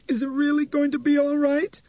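put-on high, frightened child's voice; a scared girl begging for comfort; frantic little girl. A narrator's reading. Dinah pleads again, frightened.

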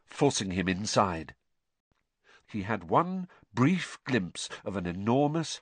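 usual speaking voice

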